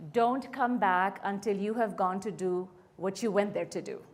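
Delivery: ordinary talk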